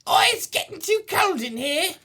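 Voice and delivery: very high British falsetto; put-on high-pitched British woman; woman's voice. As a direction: squeal it like a mock British lady. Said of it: "do the woman's voice".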